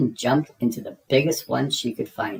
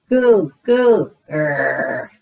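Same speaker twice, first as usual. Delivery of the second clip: put-on baby babble; 2 semitones higher, 7 dB louder, much slower than usual. A narrator's reading. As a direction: babble like a baby, then growl. Baby George with his toy.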